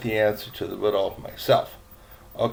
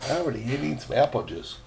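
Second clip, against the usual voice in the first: ordinary delivery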